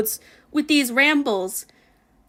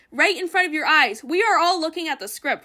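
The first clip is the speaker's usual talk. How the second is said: ordinary delivery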